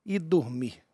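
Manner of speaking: dramatic slow storyteller voice; story narration. Acted. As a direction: narrate it like a mystery documentary. S0 narrates the UFO case.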